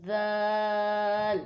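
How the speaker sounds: دال held long with a steady vowel; long madd on dal. Shown as a demonstration. Teacher answering her own how, demonstrating دال. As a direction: stretch the a of دال. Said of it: "کیسے؟".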